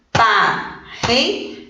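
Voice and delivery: slow "Parreira" split as par-rei-ra, with gaps; syllable by syllable. Shown as a demonstration. Teacher separating syllables with the class, "Parreira" slowly in three pieces. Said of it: "Vamos separar?".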